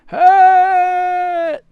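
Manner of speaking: high, long, loud shouts; yelling clothes. Acted. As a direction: scream each word, stretching the vowel. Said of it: "start doing the same rough thing".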